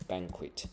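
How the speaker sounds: banquet stressed on "ban", T sounded at the end; stress on first syllable. Shown as a demonstration. Teacher repeats the word for students, stress at the front. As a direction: softly model banquet, front stress and final T. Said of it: "重音在前面不一样".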